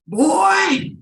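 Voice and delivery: high, loud, drawn-out angry holler; imitating a furious father yelling for his son; aggressive dad. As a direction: bellow it like an irate American dad. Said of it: "very aggressive, red-faced man".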